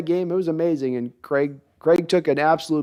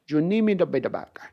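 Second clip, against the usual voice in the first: Scarface impression; 4 dB quieter than usual. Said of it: "I can do a little bit of Scarface".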